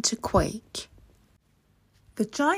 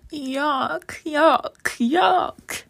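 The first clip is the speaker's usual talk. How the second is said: rising, much higher yucks; Toddy's disgusted cry; 7 semitones higher, 4 dB louder, much slower than usual. A narrator's reading. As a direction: squeal out in disgust, climbing each time. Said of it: "cried Toddy".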